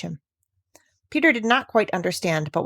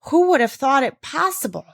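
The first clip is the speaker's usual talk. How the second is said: exclaiming; 6 semitones higher than usual. A narrator's reading.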